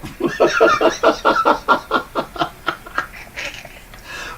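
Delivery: long breathy high laugh; high cackling laugh. Real, not acted. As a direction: burst out cackling at yourself. Laughing at own joke.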